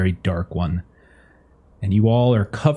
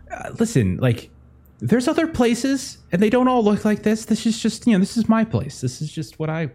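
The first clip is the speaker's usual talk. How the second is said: higher, flustered excuse; embarrassed and defensive; 10 semitones higher than usual. Acted. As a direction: awkwardly justify himself.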